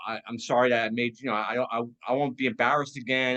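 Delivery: ordinary delivery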